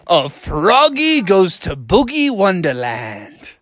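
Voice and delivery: slow, drawn-out, big swooping story intro; story-record narrator, slowly savoring the title; storyteller title announcement. A narrator's reading. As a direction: announce the tale with grand, stretched words.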